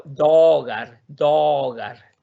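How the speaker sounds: "dogar" with the o held long; long vowel. Shown as a demonstration. Teacher showing students a long vowel, "dogar" drawn out. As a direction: stretch out the o in "dogar".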